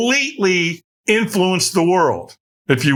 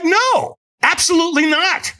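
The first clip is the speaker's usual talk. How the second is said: higher shouted refusal; angry refusal; 13 semitones higher than usual. Retold or imitated. She refuses the stranger's offer with a shouted no. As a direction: bite his head off with a shouted no. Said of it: "I about tore his head off".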